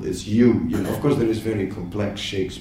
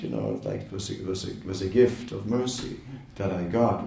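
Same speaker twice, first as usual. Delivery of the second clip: ordinary talk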